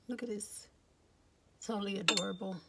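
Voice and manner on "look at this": whispering. Real, not acted. Unvoiced whisper showing item.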